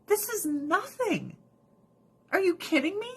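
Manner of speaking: high-pitched acted disbelief; high, exasperated incredulity; incredulous. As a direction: stretch it up high, scoffing.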